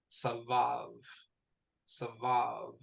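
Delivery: Savav, "sa" then "vav"; Hebrew word modelled. Shown as a demonstration. Teacher modelling Savav for the student, slow and clear. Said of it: "So this is".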